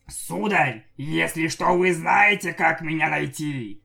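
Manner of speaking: servile floating head. A narrator's reading.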